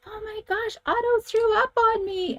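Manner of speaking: high, shocked voice of her son; son's shocked cry. Retold or imitated. Son in car after dog vomited, high shocked exclaim. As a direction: squeal it like a startled kid. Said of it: "he goes".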